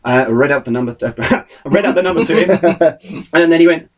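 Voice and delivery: normal talking voice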